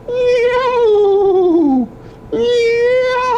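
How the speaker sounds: high, loud meow where a neigh was promised; joking meow. Shown as a demonstration. Grandpa teasing with a meow instead of a horse. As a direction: play the silly wrong animal. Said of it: "We're gonna try a horse sound".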